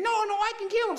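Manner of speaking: high, squeaky little-guy voice. Retold or imitated. Little David greeting the others, high and squeaky. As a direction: pipe up small and eager. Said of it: "You can't do anything".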